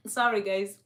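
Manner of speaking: throat catching after choking; clearing a choked throat. Real, not acted. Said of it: "I don't know why I'm choking".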